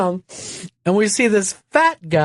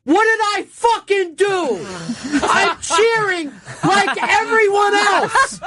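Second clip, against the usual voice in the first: high-pitched mock protest, words dragged out; retold indignant yelling; slower than usual. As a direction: imitate a drunk fan yelling in protest, way up high. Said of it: "he's fucking yelling".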